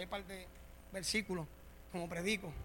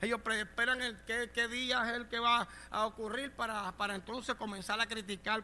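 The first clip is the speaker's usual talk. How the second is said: ordinary delivery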